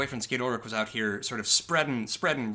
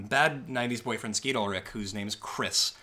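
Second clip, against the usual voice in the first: ordinary talk, nothing marked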